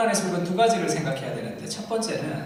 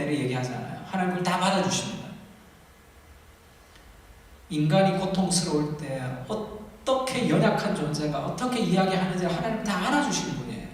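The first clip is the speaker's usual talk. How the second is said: normal talking voice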